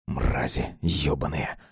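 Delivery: low, slow, heavy swearing; cursing. A narrator's reading. Мишка swears over his beer, cursing low and slow. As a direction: curse under a heavy weight, drawn out. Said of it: "выругался Мишка".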